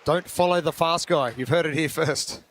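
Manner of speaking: ordinary talk, nothing marked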